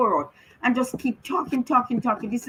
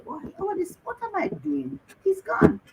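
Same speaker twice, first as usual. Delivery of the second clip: a hint of shocked grief, higher; lightly voiced dismay at her own habit; retold grief; 11 semitones higher than usual. Mother retelling the moment she realized her son was gone, with shocked grief. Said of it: "I said".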